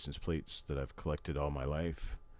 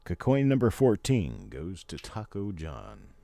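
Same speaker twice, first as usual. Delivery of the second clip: announcer; 3 semitones higher, 8 dB louder, slower than usual. Acted. Announcing a coin winner.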